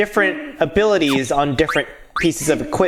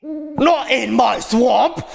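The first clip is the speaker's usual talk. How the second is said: drawn-out, higher Scottish ogre cry; Shrek; 8 semitones higher, slower than usual. Acted. Streamer hams up the ogre's catchphrase, slow Scottish accent. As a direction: stretch it out in a hammy Scottish accent. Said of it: "Scottish accent".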